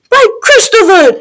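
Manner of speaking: put-on high, horrified boy's exclamation; alarmed high-voiced lad; shocked cry. A narrator's reading. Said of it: "Jack cried".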